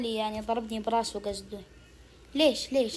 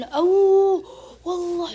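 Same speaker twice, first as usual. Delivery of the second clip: loud, high amazed oh; surprised shout; 7 semitones higher, 9 dB louder, slower than usual. Real, not acted. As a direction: burst out at the throw. Reacts to a great throw.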